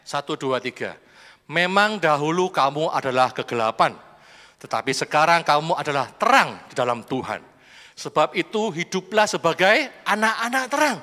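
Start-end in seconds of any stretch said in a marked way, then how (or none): 1.5-11.0 loud unison scripture reading; congregational reading. Real, not acted. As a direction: read the verse aloud together. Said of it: "Kita baca bersama ya". (membaca ayat bersama dengan lantang)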